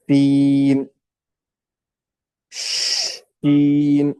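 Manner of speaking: letters sounded one by one, slow and drawn out; letter names recited. Shown as a demonstration. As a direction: call each letter clearly with long pauses. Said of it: "میں آپ کو صرف پڑھا دیتا ہوں". (حروف کو آہستہ کھینچ کر پڑھنا)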